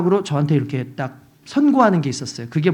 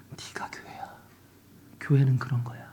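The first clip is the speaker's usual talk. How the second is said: whispered gentle voice of God; passing whisper; 5 semitones lower, 7 dB quieter than usual. Retold or imitated. God whispering to the weeping pastor, hushed. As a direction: breathe it out like a voice passing by. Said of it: "지나가는 음성처럼 들려주신".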